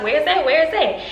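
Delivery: acting out an insistent search; retold urgent demand. Going all in his pockets, retold urgent demand.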